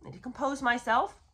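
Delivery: usual speaking voice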